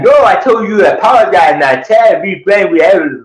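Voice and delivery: high, loud mock yelling; hammed-up high-pitched rant; Glenn. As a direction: imitate an exasperated, garbled scolding. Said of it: "yelling at me and be like".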